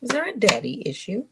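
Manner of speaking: a slip into a light British accent on the question; accidental British accent. Real, not acted. Tarot reader asking viewers about a daddy issue, in accidental British accent. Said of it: "I came out like that with a British accent".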